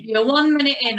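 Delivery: loud, high time call. Real, not acted. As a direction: shout the count to the riders. Instructor calling out time count.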